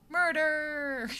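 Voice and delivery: high, drawn-out MURDER; playful cry. Pretend. Streamer rambling about being the murder, high playful cry. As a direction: call out MURDER high and long.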